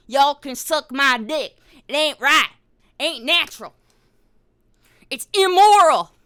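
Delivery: high shouted righteous rant; over-the-top loud, high-pitched outrage; mock moral tirade. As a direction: build to a hammed-up yell of condemnation.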